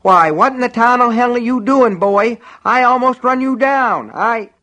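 put-on high-pitched, loud cranky old-timer's yell; outraged old man. A narrator's reading. Shaken driver to man in the road, outraged yelling. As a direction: scold at the top of a high voice.

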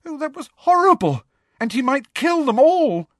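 high, distressed wail; frightened, high and rising in force; quaking. A narrator's reading. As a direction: wail it in horrified dismay. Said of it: "quaking so badly".

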